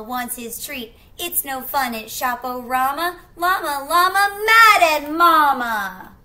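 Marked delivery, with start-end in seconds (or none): 1.2-6.2 louder and higher into a full tantrum wail; from a grumble to a furious wail; building tantrum. Acted. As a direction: rise into a child's meltdown.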